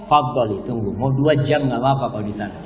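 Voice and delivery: low, easygoing acted reassurance; patient indulgent husband. Husband letting wife take two hours, patiently. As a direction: reassure her calmly, pitch dropped.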